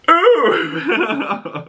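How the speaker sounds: real amused gasp; delighted ooh. Real, not acted. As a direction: coo with glee at a burn. Reacting to the grave line.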